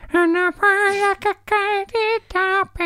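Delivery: very high, slow falsetto; hammed-up high disco voice. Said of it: "Sounded like this".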